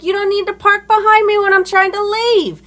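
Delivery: high-pitched, heated comeback; retold angry retort. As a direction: fire back in a re-enacted quarrel. Her retort in retold quarrel.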